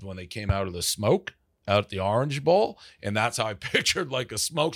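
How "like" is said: normal talking voice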